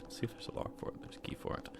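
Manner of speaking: hushed under-breath check; whisper. Real, not acted. Gamer whispering to himself while checking a lock. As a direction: whisper it to yourself.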